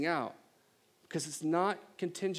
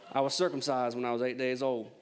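Southern accent; 3 semitones lower than usual. Shown as a demonstration. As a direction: read it in a put-on Southern accent, pitched low. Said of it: "I kinda like to read this in a more southern accent".

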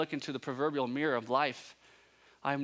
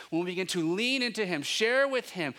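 higher, louder building sermon; building preaching; 7 semitones higher, 6 dB louder than usual. Real, not acted. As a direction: rise into it with urgency.

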